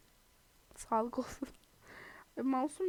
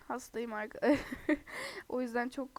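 ordinary talk

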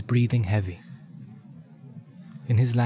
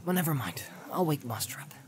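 whispered; hushed Sam; 5 semitones higher, 8 dB quieter, faster than usual. Acted. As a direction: Sam the hobbit whispering not to wake anyone.

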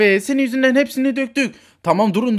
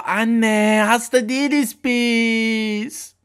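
slow, drawn-out whine; whiny protest; much slower than usual. Acted. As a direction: drag out the words in complaint. Child whining protest to mother. (mızmızlanarak, uzatarak)

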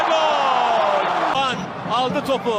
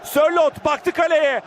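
ordinary delivery

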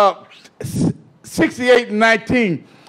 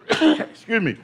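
ordinary talk, nothing marked

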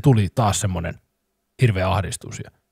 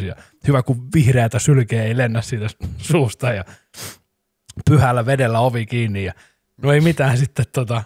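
usual speaking voice

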